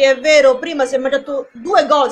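usual speaking voice

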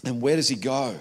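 usual speaking voice

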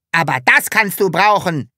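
bully shouting while kicking Sams; Hubert shouting. A narrator's reading. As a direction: yell as he kicks. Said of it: "schrie Hubert".

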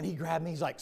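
ordinary talk, nothing marked

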